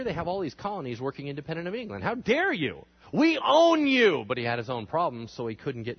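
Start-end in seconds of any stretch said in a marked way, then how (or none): 2.0-4.2 indignant high, loud outburst, drawn out at the end; outraged king. Acted. King Charles scolding the runaway colonies, outraged. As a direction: thunder it like an offended monarch.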